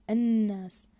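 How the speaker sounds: "الناس" with a long, pressed ن; stressed doubled letter. Shown as a demonstration. Teacher showing pupils the doubled nun, stressed doubled letter. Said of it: "نشد على الحرف المضاعف".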